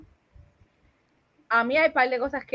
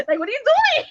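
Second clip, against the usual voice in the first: much higher-pitched, exasperated question; incredulous mock protest; 13 semitones higher than usual. Woman scolding a clumsy lover, high incredulous protest. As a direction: squeal it in mock disbelief. Acted reaction, repeated higher.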